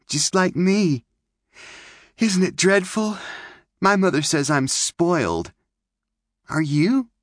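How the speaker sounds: put-on high, bright little-girl chatter; girlish May voice. Young girl May chattering to Clint by the camp. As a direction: play the pert pioneer girl.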